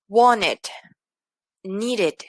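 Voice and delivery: "wanted, needed" with the -ed as its own syllable; -ed as written. Shown as a demonstration. Teacher showing past tense after T or D, -ed fully pronounced. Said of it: "sí pronuncio así como está escrito".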